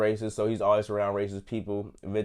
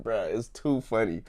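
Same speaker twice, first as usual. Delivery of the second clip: ordinary delivery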